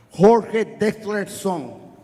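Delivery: usual speaking voice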